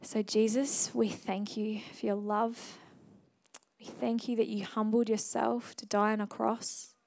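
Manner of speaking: soft, hushed prayer with long pauses; heartfelt prayer. Real, not acted. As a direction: speak quietly to God, phrase by phrase. Leads the congregation in prayer.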